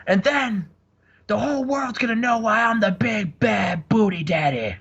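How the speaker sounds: Scott Steiner promo. Retold or imitated. Host imitates Scott Steiner cutting a promo on the mic, high. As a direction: ham up a strained, high wrestling boast. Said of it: "he says".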